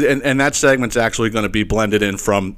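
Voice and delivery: usual speaking voice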